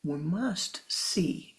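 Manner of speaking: normal talking voice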